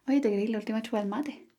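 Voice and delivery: quick, run-together Chilean delivery; fast Chilean phrasing. Shown as a demonstration. Teacher showing how fast Chileans say it, rushed. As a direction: rattle it off, swallowing consonants. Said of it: "Así de rápido debe decirlo".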